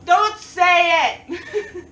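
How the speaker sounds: loud, drawn-out comic shout; emphatic warning. Pretend. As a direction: yell it slowly as a mock warning.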